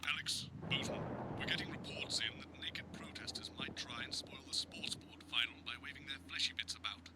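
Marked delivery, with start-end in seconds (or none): 1.4-7.1 hushed whisper through a headset; earpiece whisper. Real, not acted. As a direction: whisper urgently down the line. Producer in earpiece.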